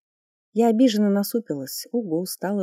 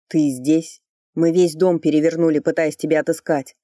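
loud reproach; 7 dB louder than usual. Acted. Ричард упрекает мать.

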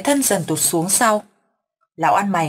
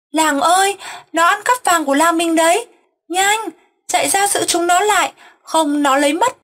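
much higher and louder, calling out to the whole village; frantic yelling; 10 semitones higher, 3 dB louder than usual. A narrator's reading. Crazed grandfather waking the village, frantic yell. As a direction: raise the alarm at the top of the voice. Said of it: "tiếng la hét".

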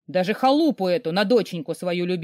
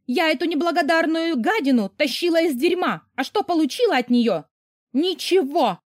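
higher, louder furious tirade; a mother raging at her daughter, higher and loud; angry mother; 8 semitones higher, 3 dB louder than usual. A narrator's reading. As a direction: hurl it at the ungrateful girl.

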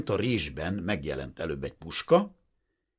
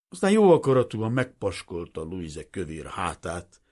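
plain reading voice

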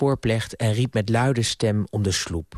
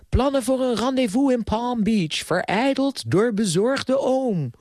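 high, theatrical mock announcer; mock headlines; 8 semitones higher than usual. A narrator's reading. Niece mocking her worried uncle, film-trailer style. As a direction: proclaim each headline with sarcastic melodrama.

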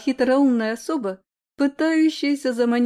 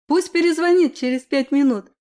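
louder, higher irritated call; annoyed exclamation; 4 semitones higher, 6 dB louder than usual. A narrator's reading. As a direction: snap back through the door. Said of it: "недовольно воскликнула я". (недовольное восклицание)

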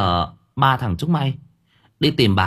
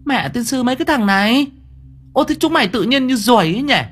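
high, loud scolding of her brothers; 3 dB louder than usual. Acted. Thơm scolding her three brothers, high and loud. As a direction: snap at the lazy boys, much higher. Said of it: "Lông mày của cô cau tít".

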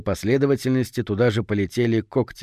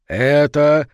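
loud, booming voice from the grave; sepulchral boom; 4 semitones higher, 6 dB louder than usual. A narrator's reading. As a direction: boom it out like a necromancer. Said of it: "замогильным голосом прогудел он".

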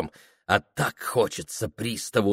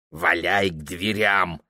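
put-on grumbling old man; 5 semitones lower, 4 dB quieter than usual. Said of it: "бурчал Вахромеюшка".

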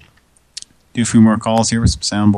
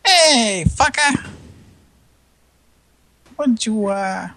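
call center guy; slower than usual. Retold or imitated. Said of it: "Guy that's like".

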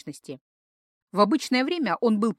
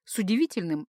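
ordinary delivery

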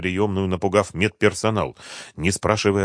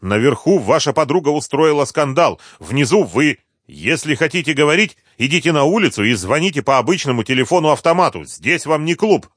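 higher, emphatic reprimand; raised voice, mild scolding; loud scolding; 6 semitones higher, 4 dB louder than usual. A narrator's reading. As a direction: lecture the troublemakers sternly. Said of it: "громко сказала она".

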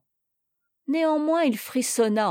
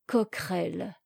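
murmured; 2 semitones lower, 5 dB quieter than usual. A narrator's reading. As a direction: murmur the name to yourself, lost in reverie. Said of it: "murmura-t-il".

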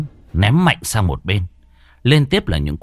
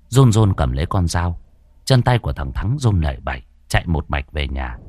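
usual speaking voice

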